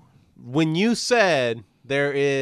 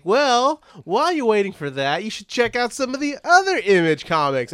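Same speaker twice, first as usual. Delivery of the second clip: salesman voice; 8 semitones higher than usual. Retold or imitated. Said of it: "he's like".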